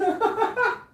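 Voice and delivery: very high giggle; tiny shrill giggle; squeaky laugh. Acted. Laugh after greeting.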